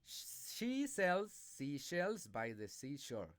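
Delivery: careful slow She sells seashells by the seashore; teacher drilling a tongue twister for learners. Shown as a demonstration. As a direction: articulate each word slowly and clearly. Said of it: "pronounce the tongue twisters".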